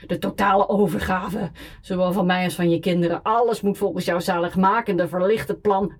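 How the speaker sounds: put-on loud sarcastic drunken rant; shouting on. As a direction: keep yelling the accusation. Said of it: "schreeuwde ik".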